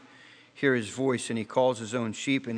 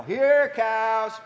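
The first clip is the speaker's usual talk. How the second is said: calling yell; 8 semitones higher than usual. Retold or imitated. Rancher's hand calling the herd to breakfast, high hollered call. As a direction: call them in across the pasture. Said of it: "I would yell".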